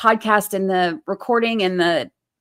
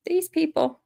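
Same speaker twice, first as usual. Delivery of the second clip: higher, soft and fed up; exasperated; 8 semitones higher, 5 dB quieter than usual. Pretend. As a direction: sigh these people out in disbelief.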